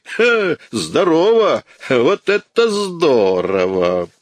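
sharp high "Ха", then a slow sneering "Вот это здорово"; mocking laugh. A narrator's reading. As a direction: jeer at him with cruel amusement. Javert mocks the request.

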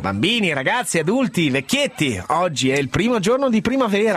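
bright show host greeting; radio host. Acted.

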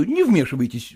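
usual speaking voice

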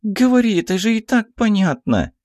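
put-on very high, girlish insistence; young archer's urging. A narrator's reading. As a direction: urge her on in a squeaky girl's voice. (высокий нетерпеливый девичий голос)

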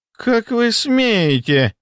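high, drawn-out, choked indignation; strangled outrage. A narrator's reading. Deputy challenged before his staff, strangled high outrage. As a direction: squeeze it out slowly at a high pitch. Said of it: "сдавленно начал он".

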